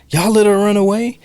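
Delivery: loud, high, furious accusation; angry yelling mom. Retold or imitated. Mom yelling at the cops, loud and furious. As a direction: shout it at the cops in outrage. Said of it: "was just like".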